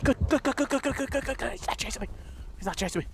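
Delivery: soft, hushed relief; relieved murmur. Real, not acted. Relieved hiding from killer.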